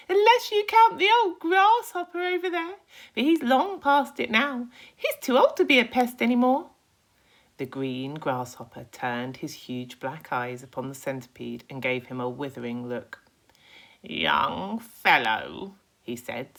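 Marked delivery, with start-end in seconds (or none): 0.1-6.7 much higher, loud proud boast; grinning pest. Acted. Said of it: "grinning away". 14.0-15.7 a slow, lightly voiced scornful old grasshopper; deep slow scornful. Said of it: "deep, slow, scornful voice".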